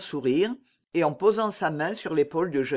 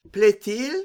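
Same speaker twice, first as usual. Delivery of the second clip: higher, louder mock-polite query; 5 semitones higher, 6 dB louder than usual. A narrator's reading. D'Artagnan feigning not to hear Mordaunt's order, lightly mocking. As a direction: ask it with a teasing lift. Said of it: "avec cet air goguenard".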